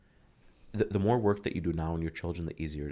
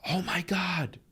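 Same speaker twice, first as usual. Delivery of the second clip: high, drawn-out mock outburst; hammed-up shrieky exasperation; child's overreaction; 12 semitones higher, 3 dB louder, slower than usual. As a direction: imitate a kid exclaiming in horror. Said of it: "His default setting now is to immediately go".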